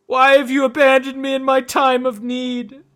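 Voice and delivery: high, loud despairing wail; anguished cry. Acted. Abandoned man crying out.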